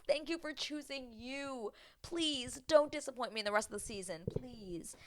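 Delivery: pleading. Retold or imitated. Pleading with Chris after his choice.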